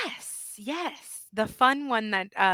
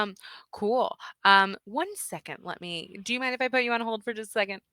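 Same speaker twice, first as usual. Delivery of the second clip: ordinary delivery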